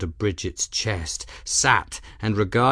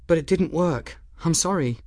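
put-on higher, light voice for a young woman, apologetic; young woman Bridget; 8 semitones higher than usual. A narrator's reading. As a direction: voice Bridget a touch higher and earnest.

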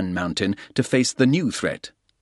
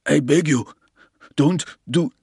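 pleading porter; 5 semitones higher than usual. A narrator's reading. Porter begging at gunpoint.

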